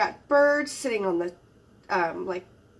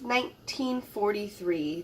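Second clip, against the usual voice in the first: ordinary talk, nothing marked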